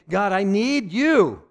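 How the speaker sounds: slow, loud cry to God; loud plea. Acted. Believer crying out to God, slow loud plea. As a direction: cry it out, dragging it slowly.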